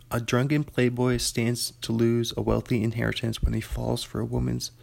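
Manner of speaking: usual speaking voice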